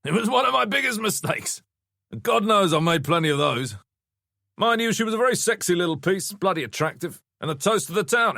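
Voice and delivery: high-pitched, breezy raconteur; brash ex-husband, scathingly amused. A narrator's reading. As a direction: hold forth breezily about your ex-wife. Voices Adrian Lockwood's dialogue.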